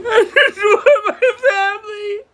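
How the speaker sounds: high, broken laugh mixed with sobbing; a desperate laugh while crying; laughing through tears. Real, not acted. Streamer repeating her plea, laugh-crying.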